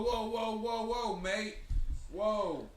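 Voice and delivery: high-pitched mock British "mate"; hammed-up British impression of a wrestling manager; bad British accent. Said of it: "I actually know how to do an accent".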